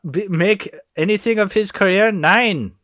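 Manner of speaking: put-on high, slow, loud German accent. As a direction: ham up a German film villain, German accent. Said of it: "I can't do a German accent".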